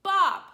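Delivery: "Bob" loud, starting high and falling sharply; emphatic, steeply falling "Bob"; fourth tone. Shown as a demonstration. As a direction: slash down hard on "Bob". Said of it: "really emphasize it strongly".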